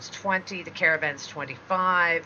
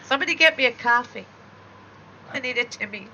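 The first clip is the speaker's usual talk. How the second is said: put-on louder, higher jokey Canadian accent; hammed-up Canadian accent; 5 semitones higher, 6 dB louder, faster than usual. Answers Russian accent with Canadian bit.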